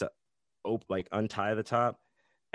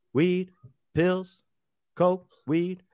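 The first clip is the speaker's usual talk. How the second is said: high, loud street-hawker calls; dealer calling out; 9 semitones higher, 5 dB louder, slower than usual. Acted. Drug dealer advertising to passers-by on Fremont Street, calling out. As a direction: toss out each item separately like a hawker.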